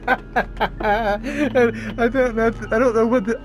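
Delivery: high, laughing in shocked disbelief. Real, not acted. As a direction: cackle through the words at the awful scene.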